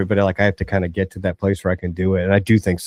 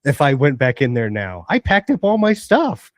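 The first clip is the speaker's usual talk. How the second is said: put-on higher, pleading voice of an embarrassed kid; mock embarrassed teen; 8 semitones higher than usual. Teen resident refusing to unpack to staff, higher, pleading. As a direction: play the teen who's too proud to unpack.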